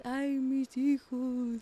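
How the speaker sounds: slow wailing ghost mother; weeping ghost woman. Acted. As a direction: wail it slowly like a ghost. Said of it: "rivers crying".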